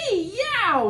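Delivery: high, loud hammed-up scream; mock scream. T. rex shrieking as Edna pecks, loud high scream. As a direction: shriek in pain. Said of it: "screamed Tyrannosaurus".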